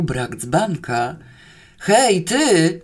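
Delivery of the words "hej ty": loud, higher angry call; terrible roar. Acted. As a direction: bellow down at someone. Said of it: "ryknął okropnie". (głośny, wyższy ryk)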